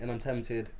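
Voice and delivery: ordinary delivery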